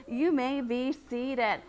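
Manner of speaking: normal talking voice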